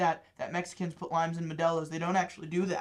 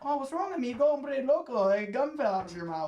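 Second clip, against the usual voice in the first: higher, louder put-on Mexican accent; 4 semitones higher, 4 dB louder than usual. Employee needles his appalled boss, taunting Mexican accent. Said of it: "doing a mid-Mexican accent".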